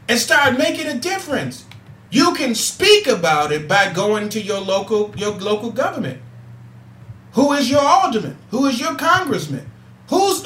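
impassioned call to action; preaching. Acted. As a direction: fire up the audience like a Sunday sermon. Rises into preaching to listeners.

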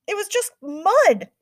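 high, loud blurt with MUD hit hard; loud panicked blurt. A narrator's reading. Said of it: "I blurt".

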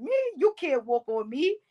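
lightly offended teasing reply; mock indignant comeback. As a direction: push back at the coach in fun. Retold group chat argument.

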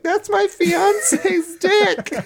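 high, slow, drawn-out woman's voice; high lady voice. Retold or imitated. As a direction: stretch it out high and prim. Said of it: "she's like".

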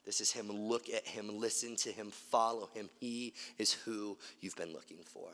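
very soft, low, hushed voice; gentle Holy Spirit. Acted. Spirit descending like a dove points out the Son, hushed and low. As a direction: drop right down, soft and low. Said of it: "the Holy Spirit falls on him like a dove going".

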